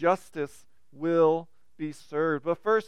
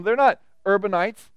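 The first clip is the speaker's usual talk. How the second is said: ordinary talk, nothing marked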